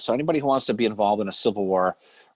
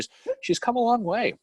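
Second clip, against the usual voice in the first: mock praise; 13 semitones higher, slower than usual. Teasing praise.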